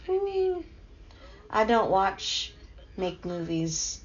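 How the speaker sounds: ordinary talk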